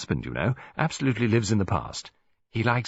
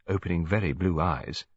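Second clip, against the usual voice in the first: plain reading voice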